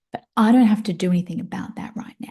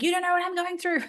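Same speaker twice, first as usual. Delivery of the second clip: much higher, softer, fast mocking echo; mocking mimic; 11 semitones higher, 5 dB quieter, faster than usual. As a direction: parrot her words back in a whiny high voice. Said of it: "And I'm like".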